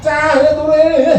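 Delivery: put-on the wrong way: shout at the child; yelling at a child. Shown as a demonstration. (membentak anak dengan suara tinggi dan keras)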